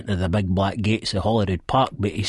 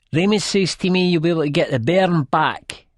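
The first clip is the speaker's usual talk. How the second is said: put-on high, drunken female voice; drunken babble; 10 semitones higher, 4 dB louder than usual. A narrator's reading. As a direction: babble it tipsily, pitch raised. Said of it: "the lassie mumbles, drunk".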